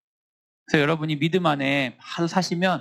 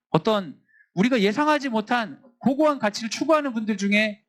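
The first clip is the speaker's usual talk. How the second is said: normal talking voice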